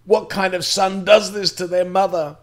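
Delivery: replaying mum's screams at her son; mother screaming at the son who left her, in fury; furious screaming mother. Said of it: "wanting to spit and scratch my eyes out".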